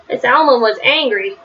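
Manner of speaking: usual speaking voice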